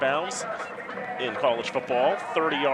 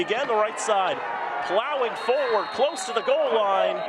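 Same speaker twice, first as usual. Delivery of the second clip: excited play call; 6 semitones higher than usual. Real, not acted. Live play call near goal.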